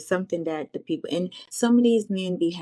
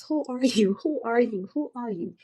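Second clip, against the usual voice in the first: confused woman; 5 semitones higher than usual. Retold or imitated. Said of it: "The only thing she kept saying".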